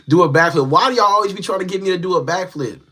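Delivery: ordinary talk, nothing marked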